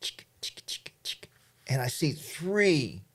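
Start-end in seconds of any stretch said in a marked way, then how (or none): 0.0-1.3 breathy unvoiced chick chick, barely there; whispered chick calls. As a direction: coax the animal with tiny whispered clicks. Stalking elk, calling softly.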